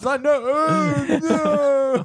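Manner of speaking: high-pitched panicked disbelief; squeaky outraged protest. Retold or imitated. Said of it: "It's like".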